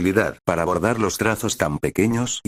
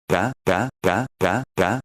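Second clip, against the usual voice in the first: lightly voiced baby babbling pa; slower than usual. Baby girl babbling pa to her father, lightly voiced. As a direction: babble pa over and over like a happy infant. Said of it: "volviendo a balbucear esa sola sílaba".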